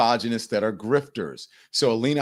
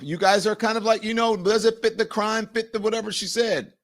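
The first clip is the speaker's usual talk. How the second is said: higher, fawning mock agreement; sing-song sycophant imitation, higher; mocking Alina Habba; 10 semitones higher than usual. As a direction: parrot her like an eager yes-woman. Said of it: "you heard Alina Habba saying".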